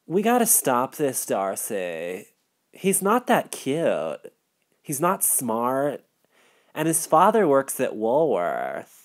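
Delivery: tired friend. Acted. Elizabeth voiced, tired and frustrated.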